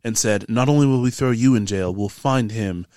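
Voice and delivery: low, measured character voice with dropped r's; serious tavern keeper. Acted. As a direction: recount a threat as a grave warning. Game master voices tavern keeper's warning.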